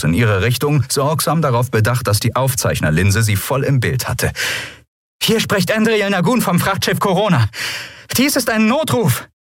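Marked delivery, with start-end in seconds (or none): 5.2-9.3 high, urgent captain radioing for help; Andriel's distress call. Acted. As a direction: send the mayday in a high, pressing voice. (hoher, dringlicher Notruf)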